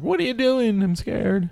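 high, hammed-up scared cry; scared wrestling bit. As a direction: squeak it out like a frightened victim. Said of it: "my wrestling stuff was like".

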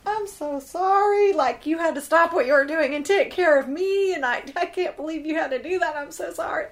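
high, loud, fast gushing apology. Retold or imitated. Patient apologizing to her nurse, high gushing sorry. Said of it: "'cause I was like".

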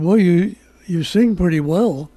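normal talking voice

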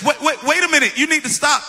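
high, loud alarmed protest; mock panic. Mom realizes the ride is moving, mock panic.

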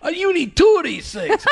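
high-pitched mock Jersey yell; hammed-up New Jersey squabbler; New Jersey accent. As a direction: play a Jersey guy mid-fight. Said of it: "a couple of guys are having a fight".

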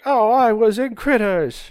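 high, slow mock British accent; a hammed-up British accent, drawn out. Podcast host mocking Kate Winslet's role, in a bad British accent. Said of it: "she did it with a British accent".

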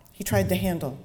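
plain reading voice

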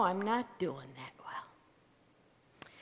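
hushed, slow whisper of a confession; ashamed whispered admission. Acted. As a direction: let it slip out under your breath. Bill confessing to the hostess.

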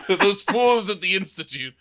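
mad scientist. Acted. Mad inventor line, laughing.

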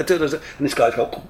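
usual speaking voice

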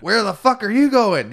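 hammed-up pirate demand; imitated pirate. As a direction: call out like a pirate leader. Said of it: "Oh, he talked like that too".

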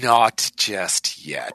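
very slow, low, drawn-out words; ominous stalling. Real, not acted. Game master holding off a player's command roll, ominously slow. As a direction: hold them back slowly, relishing the delay.

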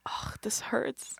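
soft slow whisper; hurt whisper. Real, not acted. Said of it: "a part of me is still like".

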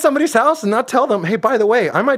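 high-pitched comic incredulity; mock indignation. Real, not acted. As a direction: protest in amused disbelief. Mock indignation at unannounced sleepwalker.

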